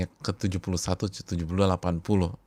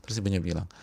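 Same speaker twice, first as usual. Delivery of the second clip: ordinary talk, nothing marked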